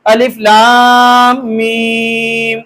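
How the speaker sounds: very slow "अलिफ लाम मीम", long level vowels; drawn-out, stopping recitation. Shown as a demonstration. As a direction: hold "लाम" and "मीम", then stop. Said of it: "ये रुक कर पढ़ने का तरीका".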